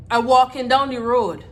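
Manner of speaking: loud, fed-up repeat; exasperated shout. Retold or imitated. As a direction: yell it out in frustration.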